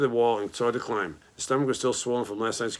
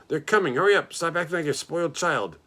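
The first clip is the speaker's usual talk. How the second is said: urgent yelling; 6 semitones higher than usual. A narrator's reading. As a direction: push him to hurry, frantic. Pig screaming at rat to hurry.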